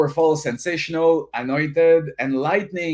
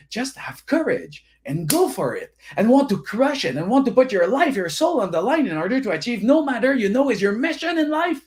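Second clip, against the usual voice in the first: fired-up push; 8 semitones higher than usual. Real, not acted. Seminar host firing up the audience, pumped. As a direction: build them up to crush it. Hype motivational climax.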